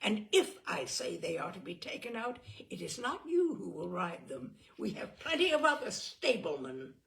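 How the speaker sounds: loud sneering furious tirade; contemptuous rage. A narrator's reading. Simon insulting Brat in a drunken rage. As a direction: pour scorn on him at full volume.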